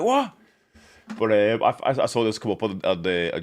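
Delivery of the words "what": high, loud startled yelp; mock surprise. Imitating viewers' reaction to sifting through a video, with loud mock surprise. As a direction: blurt it out, amazed. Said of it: "You're like".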